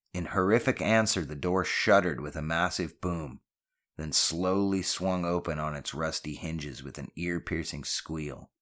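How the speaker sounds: ordinary delivery, nothing marked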